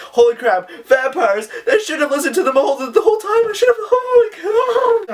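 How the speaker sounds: shrieking high and loud, half crying, in hammed-up terror; hammed-up panicked shriek, sped up at the start; mock panic. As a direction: play the little brother freaking out, voice cracking up high. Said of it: "so he's just like".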